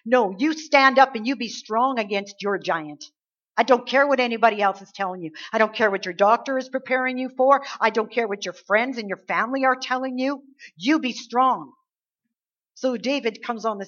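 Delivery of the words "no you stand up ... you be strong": loud, emphatic preacher urging; forceful exhortation. Real, not acted. Preacher urging listeners to be strong against their giant, forceful. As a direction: drive it home to the congregation, louder and insistent.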